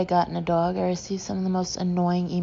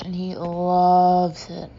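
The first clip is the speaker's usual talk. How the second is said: gushing friend; 6 dB louder, much slower than usual. Retold or imitated. Michael voiced, drawn out.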